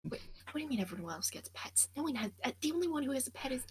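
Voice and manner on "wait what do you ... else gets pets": quick urgent whisper; hurried hushed whisper; fast whisper. Real, not acted. Whispering so camp stays asleep.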